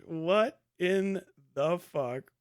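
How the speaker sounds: ordinary delivery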